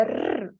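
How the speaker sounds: soft trill demo. Shown as a demonstration. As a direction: roll the tongue softly, rrr. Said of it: "Seria mais ou menos assim".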